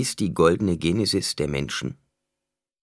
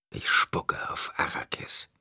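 slow, hushed whisper; whispered thought; 6 dB quieter, slower than usual. A narrator's reading. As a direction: whisper it slowly, like a private thought. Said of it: "dachte er". (langsam geflüsterter Gedanke)

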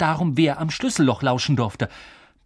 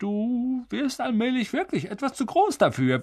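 forced niceness; 7 semitones higher than usual. Acted. Uncle offering the boy a bedroom, strained and ingratiating. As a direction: pitch climbing into strained sweetness. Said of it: "zwang dann sein Gesicht zu einem recht schmerzhaft wirkenden Lächeln".

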